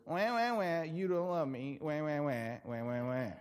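a mock toddler wail, fading away; mock whining crybaby. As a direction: wah it out like a sulking child. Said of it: "What I heard was".

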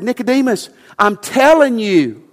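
Jesus pressing the point home to Nicodemus; emphatic. Retold or imitated. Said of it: "This was emphatic".

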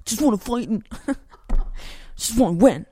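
lightly voiced eager young fighter; the impatient student who only wants to win; a hint of a hotheaded kid with a laugh; Daniel's way of talking. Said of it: "talk like that".

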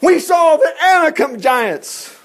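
loud, slow, high awe at the giants; loud awed spy report; booming giant report. Acted. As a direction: boom it out like a frightened spy. Spies' report acted out.

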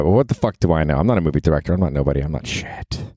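ordinary talk, nothing marked

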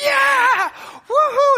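whooping crowd cheer, very high and loud; excited cheering. Retold or imitated. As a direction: cheer like the crowd watching him land.

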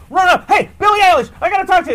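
high, loud, pushy mom voice. Retold or imitated. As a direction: call out like a mother barging in on a star. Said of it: "she was like".

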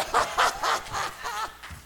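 long exultant laugh; joyful laughter. Real, not acted. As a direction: burst out laughing mid-sermon. Preacher overcome with joy.

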